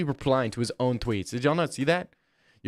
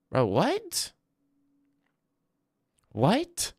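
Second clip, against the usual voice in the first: surprised; 8 semitones higher, much slower than usual. Real, not acted. Baffled by chat question.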